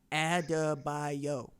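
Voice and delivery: Adebayo drawn out syllable by syllable; sounded out. Retold or imitated. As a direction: say Adebayo very slowly, each syllable held. Sounding out a hard name.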